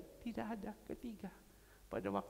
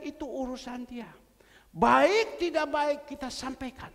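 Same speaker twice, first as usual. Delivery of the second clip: fervent preaching; 7 semitones higher, 8 dB louder than usual. Acted. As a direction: preach it with rising force. Preaching at full force.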